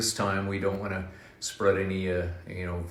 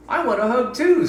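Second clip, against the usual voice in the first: high, louder bird plea; eager bird; 13 semitones higher, 7 dB louder than usual. Acted. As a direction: ask for a hug as an eager little bird. Bird asks for a hug.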